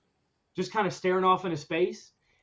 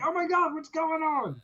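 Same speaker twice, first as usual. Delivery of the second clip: frantic; 9 semitones higher than usual. Retold or imitated. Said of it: "I'm frantic".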